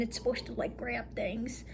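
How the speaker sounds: soft breathy whisper, slowed. Real, not acted. Storyteller whispering how the shadow power should grab things. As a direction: hush it like a secret.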